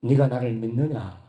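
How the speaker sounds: low, slow voice from above; voice of God. Retold or imitated. Boy kneeling at the altar hears God ask, low and slow. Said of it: "이런 소리가 들렸어요".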